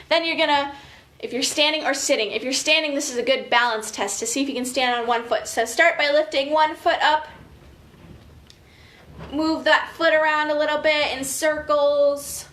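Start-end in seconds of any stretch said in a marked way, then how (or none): none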